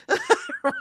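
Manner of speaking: a quick bright burst of laughter; short high laugh. Real, not acted. Laughing at her own joke about English words, short high laugh. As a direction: laugh at your own point.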